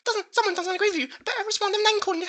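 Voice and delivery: hammed-up whiny commenter with a gasp at the horror; squeaky mocking voice.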